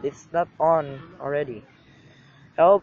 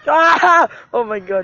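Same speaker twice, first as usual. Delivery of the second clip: startled scream; 5 semitones higher, 8 dB louder, slower than usual. Real, not acted. Creeper attack.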